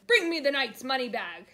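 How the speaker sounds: loud booming order; giant's command. A narrator's reading. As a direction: bark it like a greedy giant.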